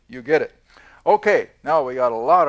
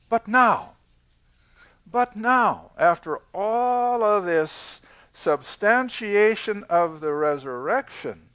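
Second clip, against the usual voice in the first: normal talking voice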